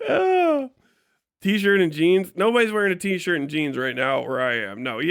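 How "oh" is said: high, breathless laughter; wheezing laugh. Real, not acted. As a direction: crack up helplessly. Laughing hard.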